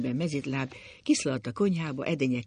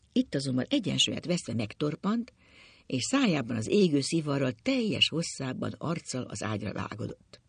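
plain reading voice

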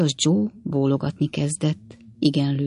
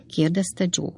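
ordinary delivery, nothing marked

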